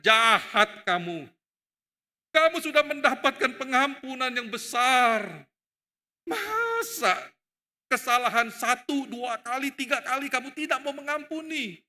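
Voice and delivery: loud, indignant scolding; king's rebuke. Acted. King scolding the unforgiving servant, loud rebuke. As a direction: rebuke him with rising pitch and force. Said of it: "Makanya raja itu mengatakan".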